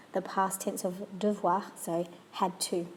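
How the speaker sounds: ordinary delivery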